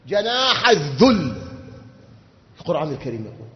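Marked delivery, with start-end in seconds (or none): 0.1-1.4 جناح الذل very slow and loud, stress on الذل; emphatic slow phrase. Shown as a demonstration. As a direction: drag out جناح الذل, landing hard on الذل. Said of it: "repeated key phrase". (جناح الذل ببطء شديد مع ضغط على الذل)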